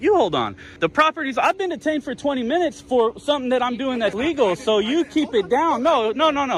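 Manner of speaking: high-pitched, agitated talking over; insistent interrupting. Real, not acted. Detained man talks over officers to stop them, agitated.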